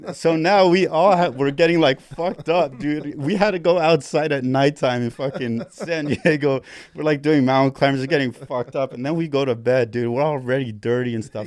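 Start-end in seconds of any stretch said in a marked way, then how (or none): 0.1-10.2 excited. Real, not acted. Excited retelling, loud.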